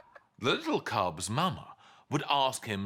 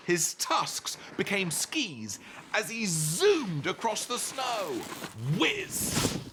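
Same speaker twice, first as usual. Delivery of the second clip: higher, slow-building thrill; excited zooming; 9 semitones higher, slower than usual. A narrator's reading. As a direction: ride the words like skis. Walrus zooms on tusks.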